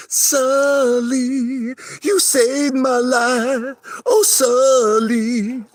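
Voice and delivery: very high, slow, weepy falsetto; mock tearful Sully tribute. Teasing Maria by parroting her song, high and slow. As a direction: wail it like a lovestruck fan. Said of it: "I told her".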